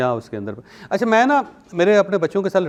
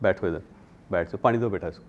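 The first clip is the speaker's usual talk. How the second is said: hospitable host; 7 semitones lower, 6 dB quieter than usual. Retold or imitated. Host welcomes guest to eat.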